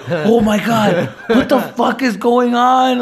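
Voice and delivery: high, slow, laughing panic; half-laughing, half-freaking out as everyone stares; panicked laughing disbelief. Retold or imitated. Retelling his high panic to his co-host, high and laughing.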